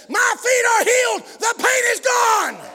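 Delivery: high, loud, drawn-out shout of healing; retold holler. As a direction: cry it out slowly as the healed woman. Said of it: "that lady hollers".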